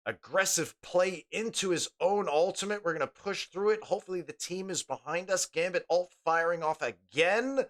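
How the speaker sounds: game caster following the play; live play-by-play. Real, not acted.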